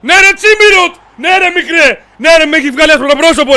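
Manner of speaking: loud, high, jubilant yelling; goal celebration shout. Real, not acted. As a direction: cheer your scorer at full voice. (πανηγυρική κραυγή για γκολ)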